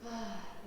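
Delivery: soft falling sigh. A narrator's reading. Dad gives in as Daisy cries, sighing. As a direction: let out a relenting sigh.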